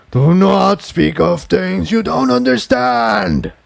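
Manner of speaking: mighty bellow. Acted. Tantalus bellows at Tiptoe after the explosion, slow and booming. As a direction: boom it out like a dragon. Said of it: "he repeated with a mighty bellow".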